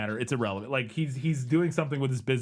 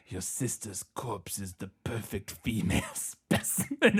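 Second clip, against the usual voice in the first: put-on German accent; 7 semitones lower, 4 dB quieter, slower than usual. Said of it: "German accent kind of thing".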